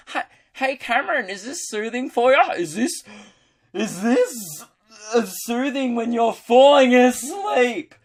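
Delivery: high, loud, deliberately grating holler; mock-soothing screech. Podcaster teases a listener who sleeps to his voice, high loud screeching. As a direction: screech it, dragging out the last words. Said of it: "I'm glad I have a soothing voice".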